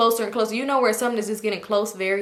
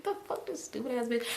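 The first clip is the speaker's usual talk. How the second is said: under her breath, much softer, a curse tossed aside; muttered insult; 13 dB quieter than usual. Retold or imitated. Cursing her drunk cousin under her breath, much softer.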